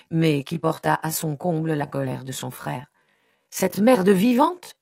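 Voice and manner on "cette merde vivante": slow, high, loud insult; furious howl. A narrator's reading. Brother rages about his sister's fiancé, furious howl. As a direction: drag the insult out in rising outrage. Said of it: "hurla-t-il".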